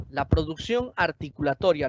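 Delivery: ordinary talk, nothing marked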